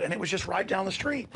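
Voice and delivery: ordinary talk, nothing marked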